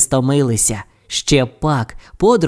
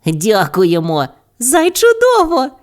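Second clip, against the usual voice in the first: slow thanks leaping very high; high little-character cheer; delighted thanks; 10 semitones higher than usual. A narrator's reading. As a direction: thank gleefully, pitched up at the end. Hares happily thank for gifts.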